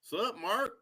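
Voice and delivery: high sing-song call to Mark; playful greeting echo. Pretend. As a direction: toss the same greeting back up high. Echoes the greeting.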